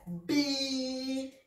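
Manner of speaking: bi with the i drawn out, slow; "bi" allongé; long bi. Shown as a demonstration. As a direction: stretch the i in bi. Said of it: "il est là pour prolonger la kasra".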